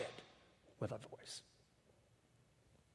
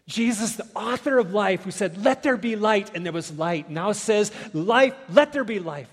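louder and higher, proclaiming; preaching at full force; 6 semitones higher, 11 dB louder than usual. Acted. Preacher to the congregation, proclaiming Jesus's command. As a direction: let it ring, slowing on let there be life.

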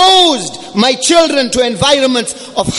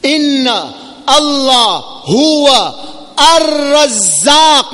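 "razzaq" drawn out slowly with heavy emphasis; slow, weighty stress on "ar-razzaq"; emphatic recitation; 5 semitones higher, much slower than usual. Shown as a demonstration. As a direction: stretch "huwa" and "razzaq" long, weighting each word. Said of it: "Nine levels of emphasis".

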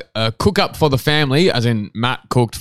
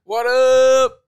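high, drawn-out "what up"; stretched, high-pitched catchphrase; showy intro greeting; 10 semitones higher than usual. Shown as a demonstration. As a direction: sing out a big high-pitched hello. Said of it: "I'm just changing up the intro".

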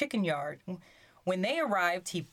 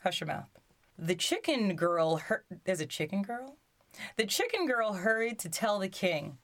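ordinary delivery, nothing marked

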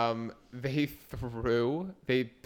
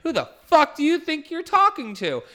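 high, loud furious scream; 9 dB louder than usual. Retold or imitated. Bartender screaming at stiffing customer, loud and high. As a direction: shriek it at the customer. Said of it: "my manager just heard me scream".

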